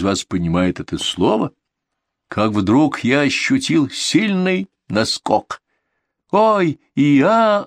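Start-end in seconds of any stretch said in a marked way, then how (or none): 6.3-7.6 high, slow gasp "Ой, и я!". A narrator's reading. The friends gasp at the story, high and loud. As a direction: everyone gasps together, pitched up and louder. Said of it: "ахнуло всё общество".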